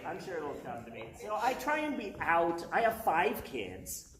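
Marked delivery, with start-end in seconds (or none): 0.1-1.0 much lower and softer, to herself; soft aside. Shown as a demonstration. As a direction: mutter it under the breath as an afterthought. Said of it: "repeats it more softly".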